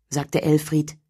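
plain reading voice

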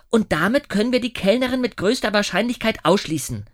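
put-on a girl confidently laying out her deduction; Maja. A narrator's reading. (Mädchen, höher und lauter, überzeugt)

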